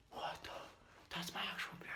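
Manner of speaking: stunned whisper. Real, not acted. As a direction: hush it in disbelief. Shocked at his beard.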